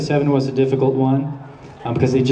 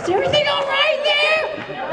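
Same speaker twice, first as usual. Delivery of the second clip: put-on much higher falsetto old-lady hello; terrible girl voice. Shown as a demonstration. Voice actor demoing his terrible girl voice, shrill falsetto. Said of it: "really terrible".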